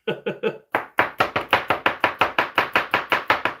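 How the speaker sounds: long chuckle at own story; real drawn-out breathy laugh. Real, not acted. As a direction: chuckle away at your own anecdote.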